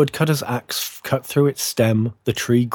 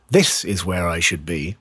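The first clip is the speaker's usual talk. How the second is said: lower, self-important tree voice; bursting with pride; 5 semitones lower than usual. A narrator's reading. Fir tree in the grand room, low and proud. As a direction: state it low with smug pride. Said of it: "bursting with pride".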